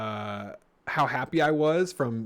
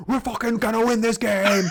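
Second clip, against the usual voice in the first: high, loud sudden elation; excited shout; 10 semitones higher, 4 dB louder than usual. Retold or imitated. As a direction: burst out in triumph. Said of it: "I was".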